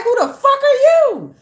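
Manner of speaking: very high, loud mock yell; mock shout back. Reader firing back at the spirit, hammed-up shout. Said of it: "And I was like".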